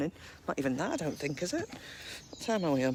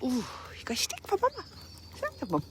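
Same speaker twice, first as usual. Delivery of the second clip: doggy talk; 10 semitones higher, faster than usual. Real, not acted. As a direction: coo at the dog quickly and very high. Baby talk to dog.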